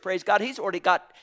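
ordinary talk

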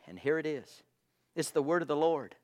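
ordinary talk

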